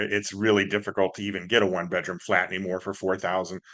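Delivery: ordinary talk, nothing marked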